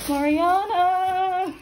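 drawn-out happy call of a name; excited call. Real, not acted. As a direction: call her name slowly and happily. Spots old friend, calls out.

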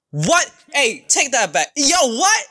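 high-pitched, affronted outburst; outraged disbelief. Real, not acted. Fan demanding a character take it back, outraged. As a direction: protest in high, shocked disbelief. Outraged at father's insult on screen.

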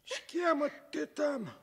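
put-on a puzzled old grandpa calling out; the grandfather asking what the noise is. Old grandfather voiced in story.

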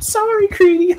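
high, breezy sorry as she rides past; mock Nora. Mimicking Nora running him over on her horse, high and airy. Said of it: "just said".

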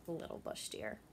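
hushed breathy name; whispered. Real, not acted. Teacher telling the class about the clever deer, whispered. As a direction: whisper it like a secret.

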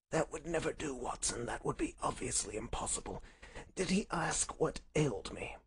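soft, weak, crisp sickbed voice; feeble invalid Holmes. Acted. Sick Holmes questions Watson about the visitor, feeble voice. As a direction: object faintly, with little strength. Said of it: "he spoke in a feeble voice".

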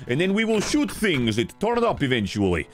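put-on halting, broken-English foreign accent; short choppy phrases in a mock foreign accent. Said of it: "Don't know why I'm doing this accent".

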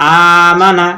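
slow, stretched start to আমানা; drawn-out opening vowel. Shown as a demonstration. Teacher demonstrates the hamza, first vowel of আমানা held long. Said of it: "Hamza টেনে পড়ছি".

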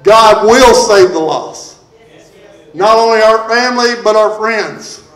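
building sermon cadence. Real, not acted. Preacher declaring salvation for family, friends and community, building preaching. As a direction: build emphatically toward a rallying cry.

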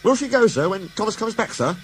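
high-pitched, eager little engine; Percy asking. Acted. Percy asks the Fat Controller about Daisy, polite high voice. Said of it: "asked Percy".

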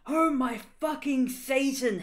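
slow, loud, high mock-panic oath; hammed-up horrified outburst; loud drawn-out exclamation of alarm; shouted. A narrator's reading. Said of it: "Harry shouted".

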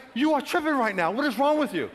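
high, loud telling-off; exasperated scolding. Retold or imitated. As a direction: chide him loudly in disbelief. God scolding him, retold.

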